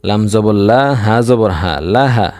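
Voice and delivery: ordinary delivery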